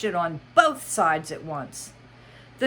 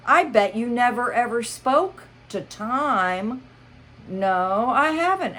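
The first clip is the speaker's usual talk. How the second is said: character voices; 6 semitones higher, 5 dB louder than usual. Acted. Hatter and Alice dialogue.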